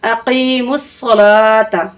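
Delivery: अकीमुस सलाता very slow, वाव silent, ला stretched; slow Quranic recitation. Shown as a demonstration. Teacher slowly recites अकीमुस सलाता for students. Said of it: "थोड़ा सा खींच कर पढ़ेंगे".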